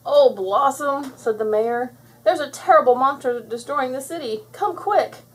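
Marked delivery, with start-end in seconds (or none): 0.0-1.1 high, loud, drawn-out plea; alarmed mayor. Acted. Mayor phoning Blossom about a monster, alarmed. 2.2-5.2 high, urgent plea for help; alarmed mayor. Acted. Mayor begging Blossom to save the city, alarmed.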